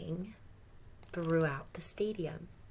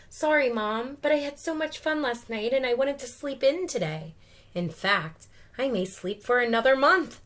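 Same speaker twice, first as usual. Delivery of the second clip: put-on higher, louder sleepy kid voice; a cheeky kid answering his mom; raised kid voice, quick; Fanatic's voice; 6 semitones higher, 6 dB louder than usual.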